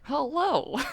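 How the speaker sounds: silly high robotic hello; sing-song stupid voice; automated voice. Acted. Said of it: "an automated voice going".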